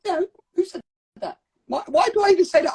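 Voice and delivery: high-pitched, mock-indignant questioning; acted challenge. Challenging whoever said crying is forbidden, high acted indignation. Acting out challenging inherited beliefs.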